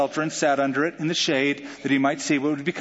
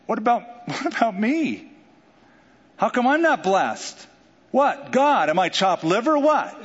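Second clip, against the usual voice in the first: indignant whine; 6 semitones higher than usual. Acted. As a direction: whine like someone overlooked.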